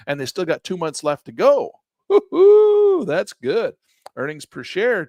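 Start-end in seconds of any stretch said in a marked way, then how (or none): 2.1-3.1 high, loud cheer of delight; excited woo-hoo. Real, not acted. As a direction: whoop for joy at the numbers. Cheering good sales numbers.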